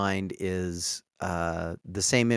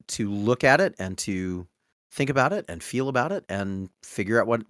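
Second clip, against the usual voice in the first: ordinary talk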